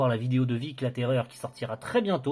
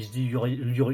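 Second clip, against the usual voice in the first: usual speaking voice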